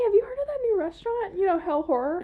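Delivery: high, quick, through laughter; giggly, high-pitched pretend chat; laughing mock question. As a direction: act out the question while cracking up. Joking acted question, cracking up.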